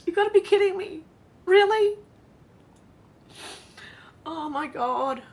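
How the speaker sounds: high, loud, helpless laughter breaking through the words; laughing till crying. Real, not acted. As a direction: crack up so hard it turns to tears. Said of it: "I'm gonna cry from trying not to laugh".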